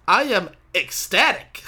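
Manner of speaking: ECSTATIC slow, loud and drawn out; mock-imitate hugging the word ECSTATIC; whole-body emphasis. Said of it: "you put your whole body into that word".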